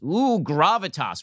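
long, drawn-out mocking ooh; mock impressed. Host mocking the article's praise of Kerry, mock impressed. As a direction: stretch it in sarcastic awe.

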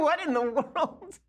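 high, slow, cracking up while asking; barely able to speak for laughing. Real, not acted. Laughing at awful anthem clip.

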